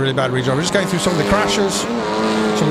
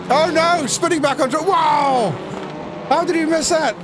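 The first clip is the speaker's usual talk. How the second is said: excited shout; 10 semitones higher than usual. Real, not acted. Caster reacting to a lucky near miss, excited.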